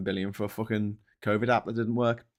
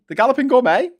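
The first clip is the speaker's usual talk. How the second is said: surprised question; 13 semitones higher, 8 dB louder than usual. Real, not acted. Reading name from chat.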